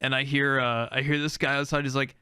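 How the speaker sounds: normal talking voice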